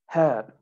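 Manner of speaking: "herb" as HUHB, R silent; British, no R. Shown as a demonstration. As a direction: sound the H in "herb", drop the R. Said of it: "no R".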